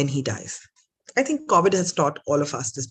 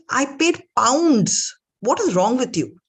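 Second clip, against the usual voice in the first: exasperated; 7 semitones higher, 4 dB louder than usual. Acted. Rich man losing patience with the guest, exasperated.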